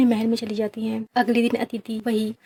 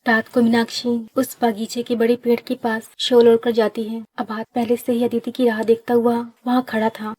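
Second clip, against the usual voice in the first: ordinary delivery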